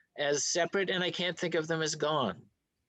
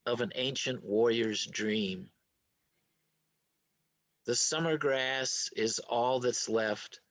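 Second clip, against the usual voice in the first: slow, measured haiku recitation; poem reading; 5 semitones lower, slower than usual. Acted. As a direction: read it slowly, falling away at each line's end. Reads a haiku aloud.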